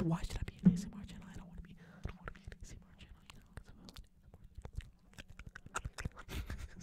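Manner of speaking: fast breathy ASMR whisper. Shown as a demonstration. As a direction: whisper it quickly, no voice at all. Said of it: "you should be an A S M R channel".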